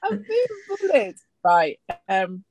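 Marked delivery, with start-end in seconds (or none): 0.0-1.2 very high, drawn-out mock wail; mock distress. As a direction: whine it high like a hammed-up victim. Mock complaint at being pressured.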